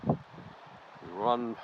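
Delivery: slow, measured imitation of a village chief's accented English; mock Amir Khan voice. Storyteller mimicking the chief's slow teasing words to him. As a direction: drag out each word like a chief teasing a foreigner.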